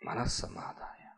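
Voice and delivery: soft, breathy whispered verse. Shown as a demonstration. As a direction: breathe the words out like a secret. Repeating verse on absorbed mind.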